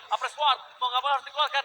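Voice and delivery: ordinary talk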